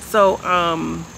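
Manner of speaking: ordinary talk, nothing marked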